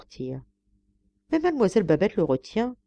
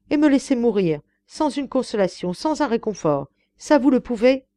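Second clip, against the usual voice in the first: raised, high-pitched reproach; protesting exclamation; 6 semitones higher, 5 dB louder than usual. A narrator's reading. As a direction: plead your outrage at being refused. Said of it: "se récria le Polonais".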